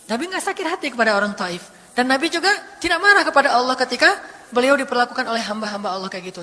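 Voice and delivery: ordinary talk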